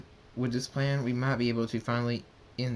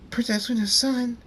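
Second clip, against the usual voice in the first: put-on high, loud girlish squeal; excited scream; 12 semitones higher, 5 dB louder than usual. A narrator's reading. Little girls meeting Luna's son, squealing loudly with excitement. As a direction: shriek it like starstruck little girls.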